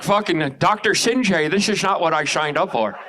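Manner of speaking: slurred "sh" for the s in "this is"; Caitlyn Jenner speech. Retold or imitated. Comic complaining to Doctor Sinjay, "signed" slurred as "shigned". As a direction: slur "signed" toward "shigned". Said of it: "they made me speak like Caitlyn Jenner".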